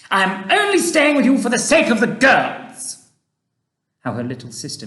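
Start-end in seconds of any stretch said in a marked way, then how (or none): 0.1-3.1 high, loud furious yell at her husband; mother's angry shout. Retold or imitated. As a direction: shriek it like a mother at the end of her rope. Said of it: "heard her mother shout".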